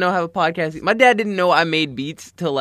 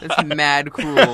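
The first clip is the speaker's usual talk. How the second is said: slow teasing drawl; much slower than usual. Pretend. Repeats teasing more drawn.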